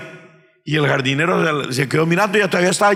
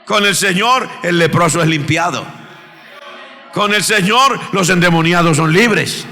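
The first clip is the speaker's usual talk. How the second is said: steady litany rising to a loud high shout; building sermon litany; 4 semitones higher, 3 dB louder than usual. Real, not acted. Preacher lists the Lord's miracles to the church, building to a shout. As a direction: build each line until it bursts out.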